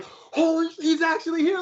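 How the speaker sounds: very high, squeaky mock freak-out; starstruck panic. Retold panic before meeting his idol.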